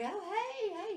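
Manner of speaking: high, eager hey hey; excited greeting. Retold or imitated. Imitating Tommy spotting her in the lobby, excited. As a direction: greet him with a bright jump up.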